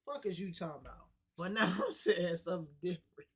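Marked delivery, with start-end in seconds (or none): 1.4-3.4 laughing. Real, not acted. Laughing through a repeated line.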